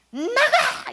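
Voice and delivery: very high, loud yelled command; furious shout. Retold or imitated. Boss throwing her out of the office, furious shout. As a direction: bark it out like an exploding boss. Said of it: "고함을 한번 빠락 지르는데".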